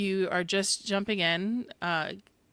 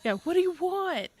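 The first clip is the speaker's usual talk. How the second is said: shrill pitched-up echo; exasperated high-pitched question; 12 semitones higher, 4 dB louder than usual. Acted. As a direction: throw up your hands, high. Echoing gif, pitched up.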